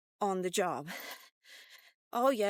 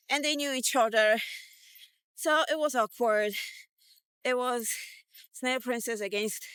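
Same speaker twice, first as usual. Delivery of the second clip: usual speaking voice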